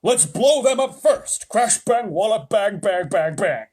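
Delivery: high, loud, slow and gleeful bang-bang; loud gleeful military voice; gung-ho army chief. A narrator's reading. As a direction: relish every bang like a war-mad general. Army chief eager for explosions.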